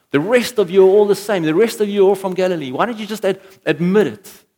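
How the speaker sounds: high, loud, insistent accusation; acted exasperated challenge; accusing. Bystander confronts Peter in acted scene.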